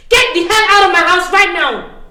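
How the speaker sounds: high, loud outburst of anger; furious shout. Acted. Host throwing her insulting friend out, furious. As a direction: explode and order them out.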